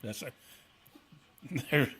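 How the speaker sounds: talking without dentures; no teeth in. Real, not acted. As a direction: lips over gums, no teeth. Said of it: "I ain't no teeth in".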